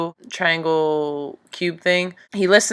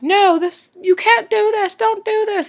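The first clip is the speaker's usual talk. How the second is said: pleading much higher, begging them to stop; desperate pleading; 13 semitones higher than usual. Retold or imitated. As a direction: beg in a panicked high voice. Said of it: "He's like".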